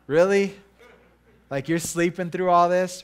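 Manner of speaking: hammed-up incredulous "Really?"; exasperated disbelief. Mother to son who slept through noise, loud mock disbelief. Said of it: "I'm like".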